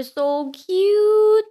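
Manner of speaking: loud, drawn-out cutesy squeal; gushing. Real, not acted. Child recalling baby deer in the yard, gushing. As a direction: stretch it out, high and adoring.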